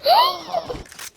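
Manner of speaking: very high sharp gasp; thrilled gasp at rare loot; loud excited gasp. Real, not acted. Finds dualies, big gasp.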